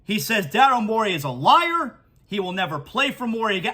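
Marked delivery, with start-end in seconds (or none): none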